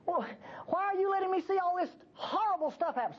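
normal talking voice

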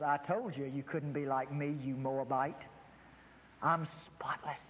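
low, smug, gloating voice for the law; lightly voiced sneer, savoring the insult; smug grinning law. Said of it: "the law is grinning and says".

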